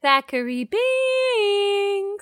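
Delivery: high, long-drawn ghostly call; calling out for someone. Retold or imitated. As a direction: imitate a sister calling for her lost brother. Imitates sister calling her brother.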